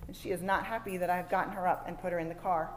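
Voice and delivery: ordinary talk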